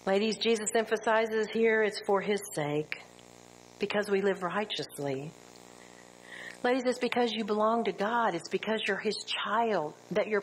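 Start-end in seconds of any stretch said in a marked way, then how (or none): none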